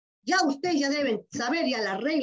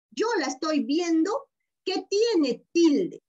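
ordinary talk

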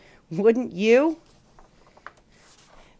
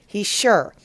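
usual speaking voice

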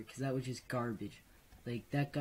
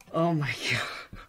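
breathy laugh through the words; relieved laughter; 3 semitones higher, 10 dB louder than usual. Real, not acted. Laughing after the scare.

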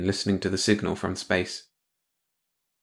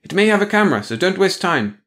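higher and louder urgent command; urgent Patara; 9 semitones higher, 5 dB louder than usual. Acted. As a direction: hurry him along, no time wasted. Patara presses Arnek urgently.

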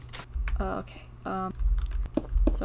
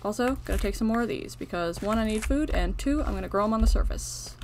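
ordinary delivery

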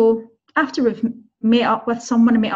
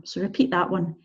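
normal talking voice

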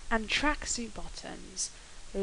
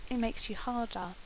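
usual speaking voice

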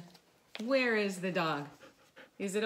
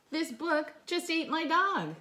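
pretend dog reading; 8 semitones higher, 4 dB louder than usual. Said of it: "are you ready to read your story".

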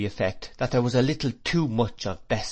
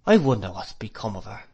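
plain reading voice

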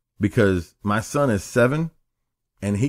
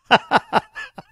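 breathy laugh; 12 semitones higher, 4 dB louder than usual. Real, not acted. Laughs at own story.

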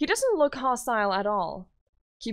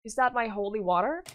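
usual speaking voice

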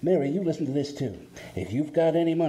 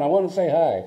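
usual speaking voice

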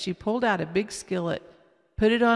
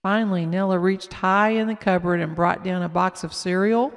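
plain reading voice

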